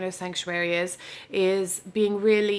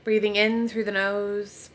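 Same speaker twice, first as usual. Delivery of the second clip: guided meditation; 3 dB quieter than usual. Real, not acted. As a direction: ease them into rest, unhurried, with long pauses. Leads a yoga nidra relaxation.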